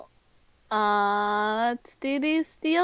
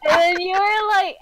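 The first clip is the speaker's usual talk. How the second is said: ordinary delivery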